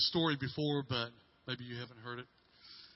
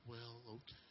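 much softer, little sniffly voice; small teary reply; 4 semitones lower, 19 dB quieter than usual. Retold or imitated. Crying daughter softly forgives her father, small and hushed. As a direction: barely get it out after crying. Daughter wipes tears and answers.

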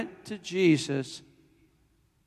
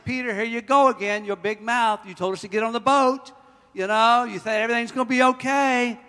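loud, raised accusing voice; mock blaming; 4 semitones higher, 5 dB louder than usual. Disciples blaming Peter in the boat, mock accusing. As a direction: lay the blame on with rising volume. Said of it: "They're probably blaming each other".

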